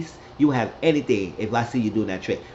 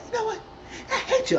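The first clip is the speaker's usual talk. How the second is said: squeaky high-pitched mock voice, half laughing half crying; high mock outburst; faster than usual. Retelling Lulu snapping at Candy, in a high squeaky mock voice. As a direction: throw it out in a shrill-sounding cartoon squeal.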